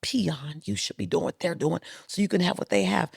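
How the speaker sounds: hushed, scornful inner voice muttering to herself; quiet mock self-reproach; self-scolding whisper. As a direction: scold yourself under your breath. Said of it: "And I'm going".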